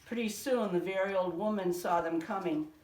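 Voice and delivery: ordinary delivery, nothing marked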